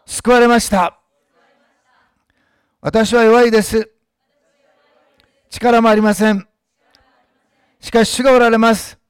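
slow loud declaration. Shown as a demonstration. Pastor leading a slow, loud declaration for the church to repeat. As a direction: proclaim each phrase slowly and loudly. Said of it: "私の言ったスピードで言ってください。".

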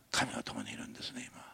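hushed whisper of a precious truth; whispered emphasis. Acted. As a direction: drop into a whisper to draw them in. Intimate truth to congregation. (ささやき声で)

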